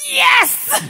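shouting Yes! for joy, high and loud; a kid cheering at the news; delighted shout. Retold or imitated. Child delighted at leaving school.

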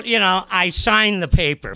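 usual speaking voice

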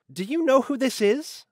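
high-pitched indignant question; demanding. A narrator's reading. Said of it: "Elise demanded".

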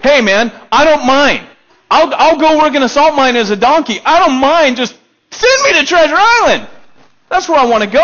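high, loud, eager pleading; gushing to be sent off, way up high; eager yes-man. Retold or imitated. Preacher mimics his child self begging to go, loud and high. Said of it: "I'm like".

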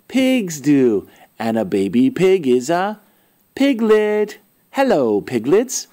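ordinary delivery

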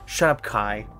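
ordinary talk, nothing marked

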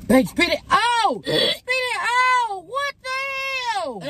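high, drawn-out panicked cries; alarmed shouting. Real, not acted. Telling partner to spit out the food, alarmed shouting. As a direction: cry out high in alarm, stretching the words. Alarm at pink stuff being eaten.

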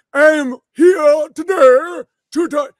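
slow, high and loud in a hammed-up British accent; mock British accent. Mocking Helen Keller's speech to a crowd, hammed-up British accent. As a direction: stretch it out like a grand British lady. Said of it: "Or she has a British accent".

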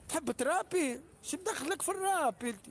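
jeering at the rapper; mocking.

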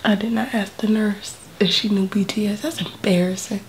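breathy whispered aside. Real, not acted. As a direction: whisper it in embarrassment. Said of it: "whisper heard, unvoiced".

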